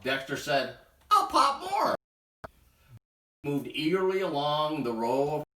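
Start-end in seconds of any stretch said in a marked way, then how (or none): 1.1-1.9 very high, loud eager squeak. Acted. Dexter promising the animals more popcorn, very high. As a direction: blurt it out eagerly.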